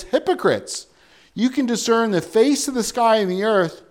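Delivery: loud, fervent preaching of scripture; preaching at full force. Acted. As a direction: proclaim it to the back pews. Jesus rebukes the city.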